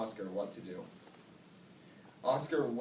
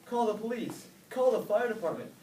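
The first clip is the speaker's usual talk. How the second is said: high, loud panicked yells; yelling; 12 semitones higher, 7 dB louder than usual. A narrator's reading. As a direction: shout for help in alarm. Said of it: "people yelled".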